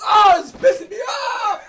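high, loud, drawn-out exasperated cry; Seinfeld-like outburst. Acted. Griping about someone who laughs while doing it, exasperated high yell. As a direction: wail your irritation at full volume. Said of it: "I sounded like Seinfeld just now".

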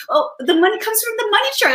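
very high, squeaky mock-child voice; childlike squeal. Host echoing the boy's money truck idea in a squeaky child voice. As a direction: squeak it out like a delighted little kid. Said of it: "quoting the boy".

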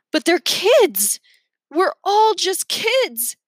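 high, drawn-out outcry; desperate plea. A narrator's reading. Pleading that they are only kids, desperate outcry.

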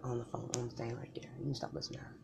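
whispered, secretive warning; hushed warning. Acted. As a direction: lean in and hiss it under your breath. Warning about her friend.

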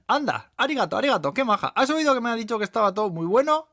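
high, loud, excited waitress voice; delighted waitress. Acted. Waitress thrilled by the compliment, excited and loud. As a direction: gush with delight, pitched right up.

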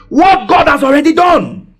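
high, ringing preacher's shout; emphatic proclamation. Real, not acted. Preacher driving home the gospel's core point, emphatic high shout. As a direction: proclaim it to the whole church.

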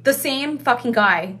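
ordinary talk, nothing marked

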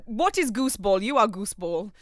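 lightly mock Italian accent, emphatic; imitating Italian boyfriend. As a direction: quote a baffled Italian with a hint of his accent. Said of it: "his immediate response was".

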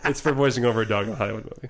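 normal talking voice

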